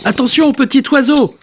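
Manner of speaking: a high warning shout to the little bird; warning cry. Acted. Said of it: "crie Monsieur Marcelin".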